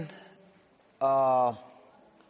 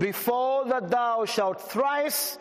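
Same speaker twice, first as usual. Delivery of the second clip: raised, slowed King James reading; scripture reading; 5 semitones higher than usual. Acted. Preacher reading Luke aloud to the congregation, raised and proclaiming. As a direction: proclaim the verse to the hall.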